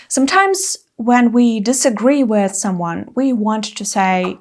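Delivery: ordinary talk, nothing marked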